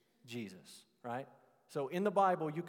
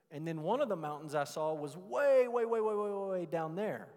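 way repeated, climbing high and loud; storyteller stretching way, way out; stretched emphasis; 4 semitones higher, 6 dB louder than usual. Acted. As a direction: paint the huge distance with each way. Stretching way to show distance.

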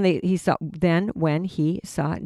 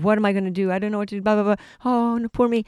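mock whining; 5 semitones higher, faster than usual. Mocking a fearful doubter.

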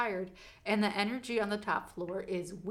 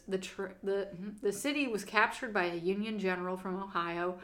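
usual speaking voice

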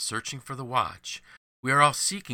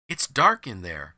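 louder, mildly incredulous exclamation; crying out; 7 dB louder than usual. A narrator's reading. Another workman questions the boy's find, crying out. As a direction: cry it out in mild protest. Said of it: "cried another".

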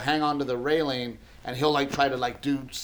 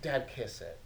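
put-on much softer, sweet little-boy request; small child plea; 3 semitones lower, 11 dB quieter than usual. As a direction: hush it like a hurt kid asking for comfort. Little boy asking for a kiss.

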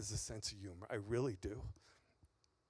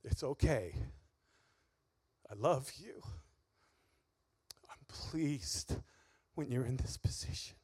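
gentle reassurance; 4 semitones lower, 6 dB quieter than usual. Retold or imitated. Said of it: "the Lord saying".

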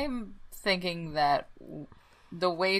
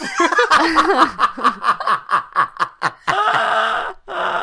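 long, high burst of real laughter; loud laughing fit; 10 semitones higher, 11 dB louder than usual. Real, not acted. Player cracks up at being asked to write a song, loud laughter. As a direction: crack up helplessly and loudly. Breaks up at own song idea.

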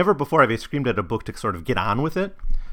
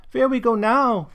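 put-on high, louder toddler voice; demanding child; 13 semitones higher, 6 dB louder than usual. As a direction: demand it like an impatient small girl. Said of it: "demanded Hilda".

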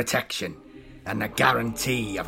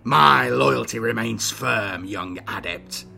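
slow, weighty reassurance, condescending; dismissive assurance; 7 dB louder, slower than usual. A narrator's reading. Older Inquisitor waving away a young accuser, dismissive. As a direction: brush off the charge, measured. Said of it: "assured him with a dismissive wave".

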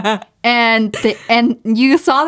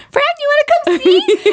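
squeaky, much higher, giggling through it; mock high voice; 4 dB louder than usual. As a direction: play the friend in a silly falsetto. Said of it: "you're like".

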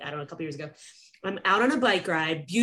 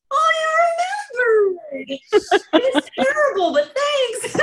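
high, loud, laughing surprise; delighted surprise; 7 dB louder, slower than usual. Retold or imitated. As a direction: squeal that he remembered, laughing. Surprised the doctor remembered.

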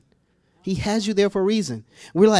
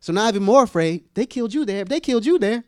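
ordinary delivery

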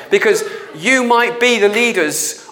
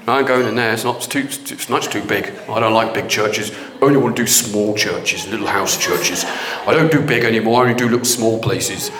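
much lower, sulky voice of God refusing big churches; petulant God; 10 semitones lower than usual. Retold or imitated. Preacher mocks the idea that God shuns big churches, low sulky voice. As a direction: play God as a grumpy, pouting refuser, low and sullen.

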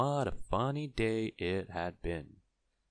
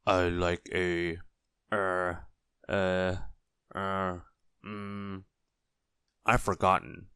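halting, drawn-out fillers while searching; absent-minded customer losing his thread; forgetful hesitation; slower than usual. A narrator's reading. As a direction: stall and grope for the lost word. Forgetful man searches for a word.

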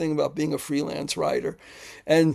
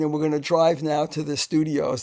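ordinary talk, nothing marked

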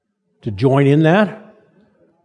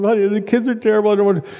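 higher, fast mock wife voice; complaining wife; 6 semitones higher, much faster than usual. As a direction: rattle it off as a put-upon wife, lightly played. Wife complaining about the kids.

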